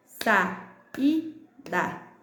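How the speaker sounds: "saída" in three slow beats, sa - í - da; sa, í, da with clear gaps; syllable by syllable. Shown as a demonstration. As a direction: isolate each syllable of "saída". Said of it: "o i ficou sozinho em outra sílaba".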